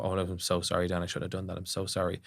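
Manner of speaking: soft, over-apologetic mock grovel; meek apology. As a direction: play up the sorry, quietly. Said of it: "you make the critical dig and go".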